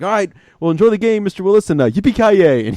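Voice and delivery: high, chirpy mock interview sign-off; TV reporter. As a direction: imitate a reporter wrapping up cheerily on air. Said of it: "one reporter".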